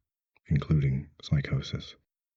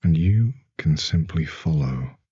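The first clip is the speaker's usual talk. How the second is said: slow, soothing guided meditation with long pauses; sleep hypnosis; 4 dB louder, slower than usual. Acted. Hypnotist easing a wakeful listener back toward sleep, calm and unhurried. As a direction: lull them gently, phrase by unhurried phrase.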